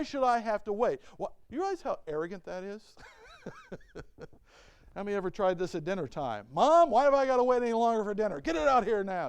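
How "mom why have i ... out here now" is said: put-on demanding child.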